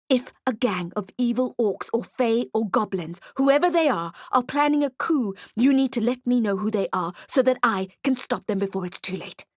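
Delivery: ordinary delivery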